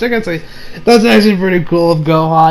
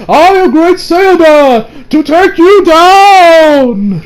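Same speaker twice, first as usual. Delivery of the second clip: high, loud, very slow hero proclamation; heroic declaration; 10 semitones higher, 6 dB louder, much slower than usual. Retold or imitated. Gohan bursting in on robbers, slow loud hero proclamation. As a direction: boom it out like a costumed hero.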